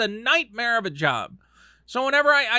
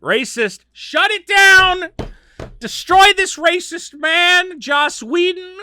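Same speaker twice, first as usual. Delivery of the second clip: higher, louder, slow mock outrage; mock outrage shout; 12 semitones higher, 8 dB louder, slower than usual. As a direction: howl it like an angry mob.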